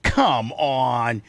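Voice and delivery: normal talking voice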